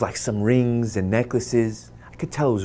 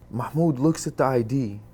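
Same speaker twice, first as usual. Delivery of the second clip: ordinary delivery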